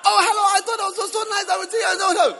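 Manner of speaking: high, loud, fast clipped talk; choppy chatter. Retold or imitated. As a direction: fire off short bursts. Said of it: "staccato answers".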